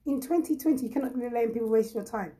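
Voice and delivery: ordinary talk, nothing marked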